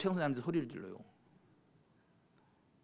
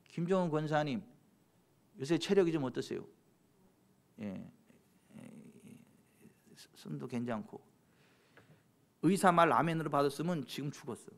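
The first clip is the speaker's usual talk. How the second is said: ordinary delivery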